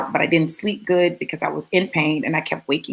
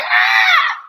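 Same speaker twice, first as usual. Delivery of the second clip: sudden very high, loud squeal; mock excited scream; 6 dB louder than usual. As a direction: shriek like you just got great news. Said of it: "girl, you're like".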